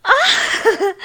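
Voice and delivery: breathless laugh. Acted. Breathless reaction during intimate scene.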